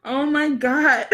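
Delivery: breathless laugh through the words, slow; can't stop laughing at the comment; laughing hard. Real, not acted. Cracking up at a comment.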